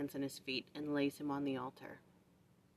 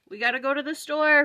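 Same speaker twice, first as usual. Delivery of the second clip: calling out; 7 semitones higher, 12 dB louder than usual. Real, not acted. Calling out to kids.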